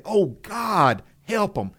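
acted desperate prayer for a child; pleading cry. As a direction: plead with God, pitch lifted, as a worried parent. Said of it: "We're not just saying, "Lord, protect 'em".

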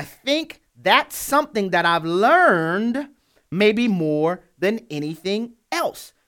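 slow, deliberate reading of a quote; real slow. Real, not acted. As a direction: say it slowly, weighing each phrase. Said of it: "I'm gonna say this real slowly".

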